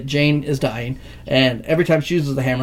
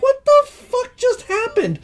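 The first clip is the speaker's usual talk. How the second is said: high, slow, incredulous what-the-fuck; can't believe what just happened; stunned disbelief; slower than usual. Real, not acted. Fan ranting to a friend about the ending, high incredulous disbelief.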